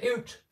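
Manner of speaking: "oot" with an oo vowel, mimicking Canadian "out". Shown as a demonstration. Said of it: "I know straight away that's a Canadian".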